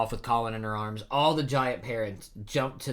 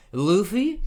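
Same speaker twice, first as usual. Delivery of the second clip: high, louder rising question; astonished question; 10 semitones higher, 7 dB louder than usual. A narrator's reading. Rescued villagers recognizing who caught them, high surprised question. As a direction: lift the name sharply in disbelief. Characters startled to hear Luffy.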